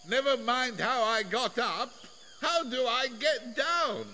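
high, thin, far-off plaintive voice; high-pitched distant plea; Wilbur. A narrator's reading. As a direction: call down anxiously in a small high voice. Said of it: "said Wilbur".

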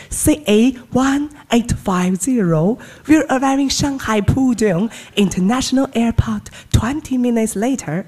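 slow, loud cabin announcement in English; careful broken English read slowly; flight attendant English. Acted. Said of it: "学学啊".

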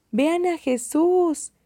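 high, drawn-out cheering call; children calling out. A narrator's reading. Children calling out to see Jesus, excited shout at moderate volume. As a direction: call out high, stretching the words. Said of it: "gritan los niños".

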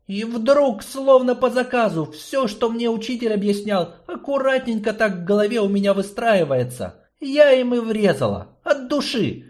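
put-on bright young girl telling her story; a teenage girl recounting, breathless and intense. Girl narrates her own escape.

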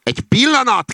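very high, loud call; calling after someone. A narrator's reading. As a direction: shout after people walking away. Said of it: "kiáltott utánunk a róka". (magas, hangos utánakiáltás)

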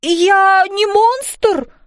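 high, very drawn-out protest; indignant protest. Acted. Fairy mum denying she is a monster, indignant. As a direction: stretch the denial high and affronted.

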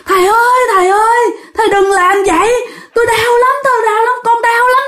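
high, wailing plea for mercy; crying out in pain. Acted. Ghost begs the master to stop, crying out in pain. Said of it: "bắt đầu la lên".

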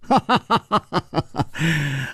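短促的笑声; 忍不住笑出来; 轻声笑. A narrator's reading. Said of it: "楚留香忽然笑了". (楚留香戏弄黑袍客时的笑声)

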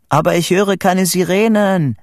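high, loud objection; shouting up. A narrator's reading. Vito answering his friend from below, shouting up. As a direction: yell it up from far below. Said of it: "schrie Vito von unten herauf".

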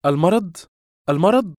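high, loud incredulous cry; exasperated anger. A narrator's reading. Woman hearing his excuse, exasperated. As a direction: throw the word back at him, furious. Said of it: "فهتفت بحنق".

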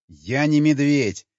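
loud, slow, drawn-out protest; insistent cry. A narrator's reading. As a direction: shout it slowly, stretching every word. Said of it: "кто это рядом кричит". (громкий протяжный крик)

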